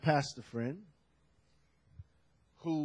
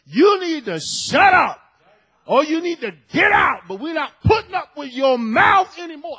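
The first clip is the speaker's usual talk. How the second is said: loud, high-pitched shouted rebuke; imitating a furious telling-off at full volume; acted angry outburst; 10 semitones higher, 12 dB louder than usual. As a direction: blow up like a fed-up pastor. Acts out pastor's final outburst.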